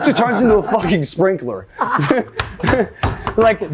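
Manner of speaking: ordinary talk, nothing marked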